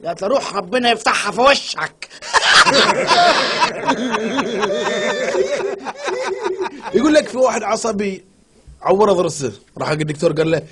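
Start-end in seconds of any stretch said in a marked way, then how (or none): 0.3-2.1 put-on Egyptian accent. Egyptian mother answers. 2.1-6.9 laughter. Real, not acted. Laughing at joke.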